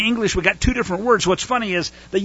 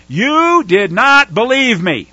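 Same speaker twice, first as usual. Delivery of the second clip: stern God; 4 semitones higher, 6 dB louder, slower than usual. Retold or imitated. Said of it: "Yahuwah says to Moshe".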